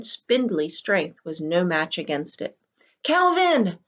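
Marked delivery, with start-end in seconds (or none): 3.0-3.8 high, loud gasped cry. A narrator's reading. Meg losing her grip, gasping Calvin's name in panic. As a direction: gasp his name in panic. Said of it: "she gasped".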